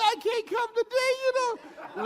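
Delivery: high, whiny little excuse; whiny refusal. Retold or imitated. Retelling his refusal to coworkers, mock whiny high voice. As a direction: whine it like a kid who won't go in.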